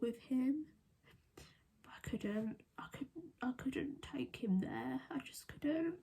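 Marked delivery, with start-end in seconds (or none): 1.4-5.9 real crying whisper, soft and halting; words falter and fade into tears; tearful. Real, not acted. Mother who couldn't take him to the morgue, tearful. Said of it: "can't talk about it without crying".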